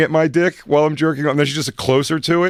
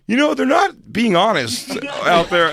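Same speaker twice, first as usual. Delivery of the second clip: high tattling complaint; 10 semitones higher, 4 dB louder than usual. Retold or imitated. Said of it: "go to the front of the guy and go".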